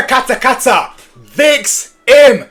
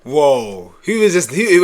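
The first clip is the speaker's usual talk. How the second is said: normal talking voice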